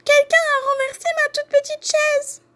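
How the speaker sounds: put-on very high, squeaky baby bear cry; tiny piercing voice at the top of the range; piercing little bear. A narrator's reading. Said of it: "crie de sa petite voix perçante".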